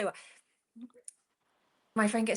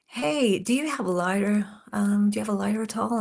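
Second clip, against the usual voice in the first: put-on American accent; 2 semitones higher than usual. American woman asking a stranger for a lighter, imitated American accent. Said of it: "excuse my accent".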